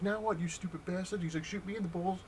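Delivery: high-pitched taunting imitation; mock gloating. Rival gloats over shot-up car, mock taunting. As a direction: jeer like a sore rival getting even.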